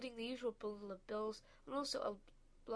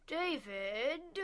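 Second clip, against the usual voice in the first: a loud, higher angry snarl at his son's name; 4 semitones higher, 6 dB louder than usual. A narrator's reading. Father at breakfast, snarling his son's name. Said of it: "he snarled".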